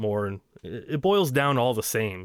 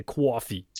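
coffee as "CWAW-fee", Jersey style; Jersey accent. Real, not acted. Host saying his own coffee, Jersey accent. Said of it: "my Jersey accent comes out".

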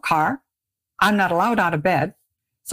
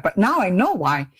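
ordinary delivery

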